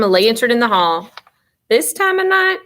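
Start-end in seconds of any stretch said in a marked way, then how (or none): 1.7-2.6 high-pitched disbelief, rising; incredulous question. A narrator's reading. Sleepy sister questions brother's night errand, incredulous.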